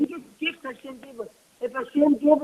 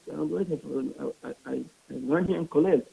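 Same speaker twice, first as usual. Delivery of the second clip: meek answer; 7 semitones lower, 5 dB quieter, much faster than usual. Retold or imitated. Poor scholar answers meekly.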